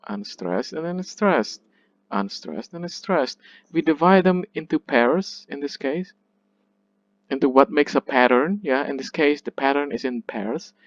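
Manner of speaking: ordinary talk, nothing marked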